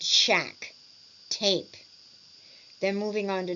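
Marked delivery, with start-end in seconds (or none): 0.0-1.8 "shack" and "tape" each falling at the end; falling intonation. Shown as a demonstration. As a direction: let the voice drop on "shack", then on "tape". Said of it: "voice falling at the end".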